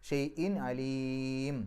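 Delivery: ഷൈഇൻ അലീം with the ലീ held very long; long drawn vowel. Shown as a demonstration. Teacher shows the class the six-beat lengthening. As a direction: stretch the ലീ in അലീം to full length. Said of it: "ആറ് അക്ഷരങ്ങളുടെ ദൈർഘ്യം വരെ നീട്ടാൻ".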